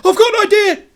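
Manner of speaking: high, loud excited shout. Acted. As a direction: cry out the plan. Jay shouting an idea.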